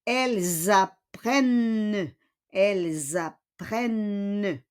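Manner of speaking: "Elles apprennent" as elle-zapprenne with held N; teacher's measured model "Elles apprennent"; Z liaison. Shown as a demonstration. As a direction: link "Elles apprennent" with a Z, ent silent. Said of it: "elle is the same".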